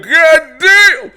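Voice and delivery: shocked yell at the gore; shouted exclamation. Retold or imitated. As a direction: blurt it out in shock. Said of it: "He's like".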